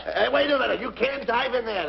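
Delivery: high, urgent objection; alarmed warning. Acted. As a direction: warn a friend off a dangerous dive. Warns friend off crocodile pool.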